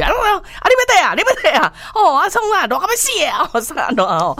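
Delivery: high, rapid bickering in Taiwanese; mock quarrel. How Taiwanese sounds to outsiders, high mock arguing. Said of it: "我们都觉得好像在吵架".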